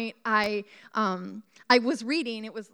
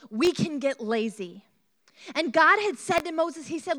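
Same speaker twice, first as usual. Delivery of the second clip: ordinary delivery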